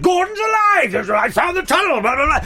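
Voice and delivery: high, roaring catchphrase shouts; hammed-up heroic yelling; Brian Blessed. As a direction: bellow at the drop of a hat. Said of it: "Brian Blessed is like".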